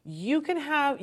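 ordinary talk

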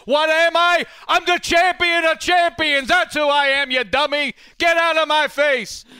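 high, loud boastful champ. Acted. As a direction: brag and shout like a champion. Acts a boastful Yankee.

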